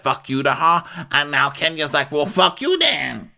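steadily high put-on voice; Teresa imitation. Teresa commenting on Ramona and Kenya, high-voiced imitation.

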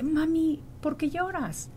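put-on a small girl's soft, worried question; daughter asking. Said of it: "ella se me queda viendo y me dice".

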